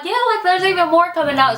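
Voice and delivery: high, fast panicky reply; panicked. Retold or imitated. Panicking as water gushes.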